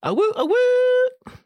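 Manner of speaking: high wolf howl, loud. Pretend. Reacting to fuckboy theme.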